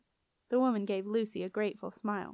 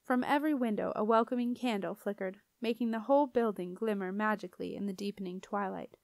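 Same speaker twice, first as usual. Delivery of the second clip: plain reading voice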